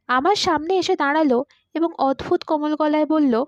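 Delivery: plain reading voice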